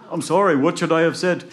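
high, loud anxious question; pleading apology. Acted. Jack asking a mourner what to say, high pleading. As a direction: plead to be told the right words.

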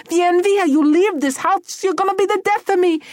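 high, loud hysterical mother; frantic, very high-pitched tirade; screaming Mama. Acted. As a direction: scream him out of the house. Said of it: "Mama screamed and took the broom".

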